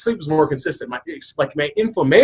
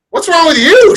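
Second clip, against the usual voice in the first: high, loud bewildered echo; confused echo back; 13 semitones higher, 8 dB louder than usual. Retold or imitated. Husband just woken, echoing his wife, high and bewildered. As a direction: throw the question right back, baffled.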